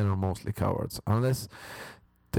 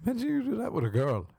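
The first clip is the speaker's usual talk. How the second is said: incredulous high rush; 13 semitones higher, faster than usual. Real, not acted.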